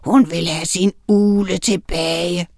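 slow, menacing creature voice; hissing rat. A narrator's reading. Evil rat demands the owl back, hissing. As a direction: stretch the words like a wicked rat. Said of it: "hvæsede den".

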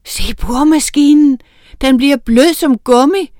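high, loud panicked yell; frightened shout. A narrator's reading. Mason shouting to his partner about the drill. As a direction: shout it out in alarm. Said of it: "råbte Tejl".

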